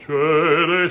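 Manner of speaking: loud, mock-dumb "uh" with a changed tone; dumb colored vowel. Shown as a demonstration. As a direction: honk a deliberately stupid vowel. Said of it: "stupidly, you know, like".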